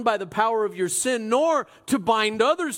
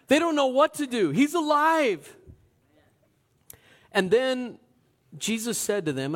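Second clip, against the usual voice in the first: normal talking voice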